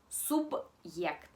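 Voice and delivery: subyect said slowly with a hard b before the y glide; Russian подъезд-style hard sign demo on subyect; Russian example word. Shown as a demonstration. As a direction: stretch out subyect, keeping the b hard and separate from the yect. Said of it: "Por ejemplo".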